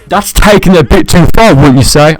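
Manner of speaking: loud mock outrage; panicking gamer. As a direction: blast it in fake shock. Mock outrage, then "I'm kidding.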